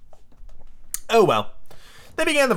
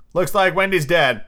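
usual speaking voice

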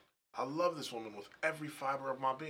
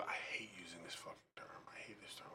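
soft unvoiced whisper, fast; whispered aside; 5 semitones lower, 14 dB quieter than usual. Real, not acted. Man grumbling quietly about a term he hates, whispered. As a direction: whisper it under your breath.

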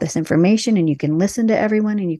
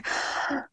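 a quick frightened intake of breath; sharp panicked gasp; 10 dB quieter than usual. Pretend. A podcast guest acting out test panic, sharp gasp. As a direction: gasp as if caught unprepared for a test.

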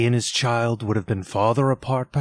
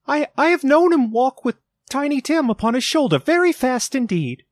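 much higher, quicker, falters once; steady cheerful voice; 4 dB louder, faster than usual. A narrator's reading. Mother remembering Tiny Tim, steady cheerful voice with one falter. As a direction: keep it cheerful though the voice catches. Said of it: "in a steady, cheerful voice that only faltered once".

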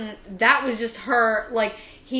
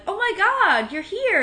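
excited mom; 11 semitones higher, 4 dB louder than usual. Retold or imitated. Mom overjoyed to see daughter.

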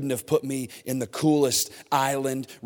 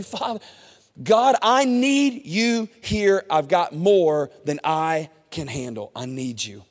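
model prayer; 5 semitones higher, 6 dB louder, slower than usual. Shown as a demonstration. Preacher modeling a prayer for listeners, slow and pleading. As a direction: pray it out slow, then drop to a hush. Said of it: "Just say".

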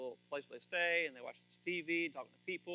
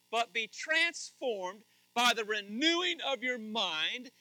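forceful scripture reading; 7 semitones higher, 10 dB louder than usual. Acted. Pastor reading Romans to the congregation, building to a loud call. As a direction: proclaim Romans twelve like a promise to the room.

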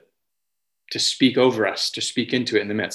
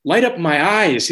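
higher, slower pleading cry on the words; 6 semitones higher, 3 dB louder than usual. Retold or imitated. Voicing David begging God for light, higher and pleading. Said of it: "It's, it's a cry of".

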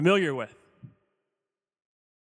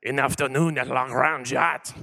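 "An Afternoon at La Grangette" in a hammed-up French accent, lower; fake French accent; 5 semitones lower than usual. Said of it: "I can speak English in a French accent".

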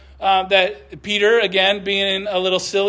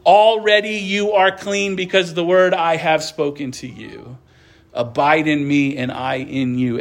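lower, measured Bible reading voice; scripture reading; 8 semitones lower than usual. Acted. Pastor reading Jesus' words to the congregation, reverent reading. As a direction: read it as holy text, unhurried. Pastor reads John fifteen aloud.

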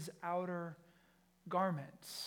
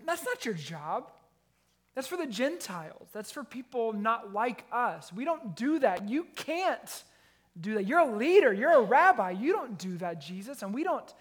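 higher, louder, protesting rant; indignant Peter; 4 semitones higher, 9 dB louder than usual. Retold or imitated. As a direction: scold the rabbi in shock. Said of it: "in an indignant way".

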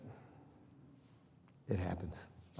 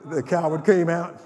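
louder punchline; 4 semitones higher, 9 dB louder than usual. Real, not acted. Full force preaching.

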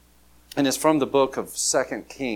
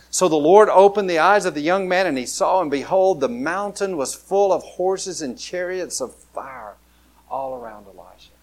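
louder, building Bible reading, slowing at the end; dramatic scripture reading; 4 semitones higher, 6 dB louder than usual. Acted. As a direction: proclaim the miracle to the congregation.

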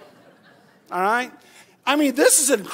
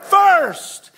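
much louder, higher; said together; 9 semitones higher, 9 dB louder than usual. Shown as a demonstration. Preacher leading the congregation to shout the answer, loud. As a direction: call it out with the crowd.

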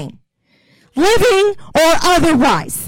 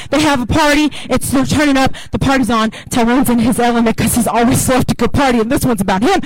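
usual speaking voice

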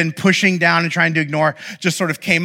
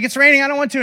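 put-on higher, whining child refusing; whiny kid; 10 semitones higher, much faster than usual. Kid refusing a photo in the rain, whiny. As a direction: whine it like a kid who wants inside.

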